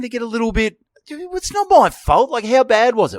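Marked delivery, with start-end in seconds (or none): 1.1-2.3 mock excuse. Voicing Tom's excuse.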